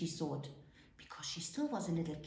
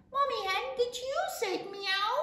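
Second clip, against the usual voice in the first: high-pitched little goat asking; little kid goat; 14 semitones higher, 5 dB louder than usual. A narrator's reading. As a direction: ask in a high baby-animal voice. Kid asks the hen.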